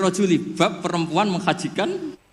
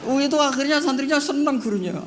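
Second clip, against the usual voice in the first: high, laughing through the words; 12 semitones higher than usual. Real, not acted. Preacher laughing as he tells of the happy students. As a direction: giggle the line out.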